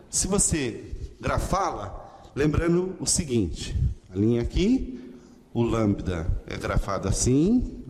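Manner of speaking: ordinary talk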